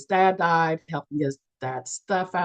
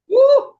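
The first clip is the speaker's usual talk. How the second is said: excited whoop; 11 dB louder than usual. Real, not acted. Excited about the key point.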